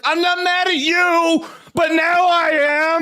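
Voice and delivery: half-joking shout that he is mad now; getting mad. Retold or imitated. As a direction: build from denial to a mock shout. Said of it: "more mad that this person's mad".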